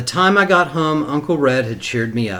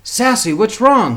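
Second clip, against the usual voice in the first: higher, louder, worried question; suddenly worried uncle; concerned asking; 9 semitones higher, 5 dB louder than usual. A narrator's reading. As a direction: uncle notices she is crying and asks. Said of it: "he asked".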